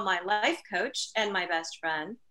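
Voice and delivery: normal talking voice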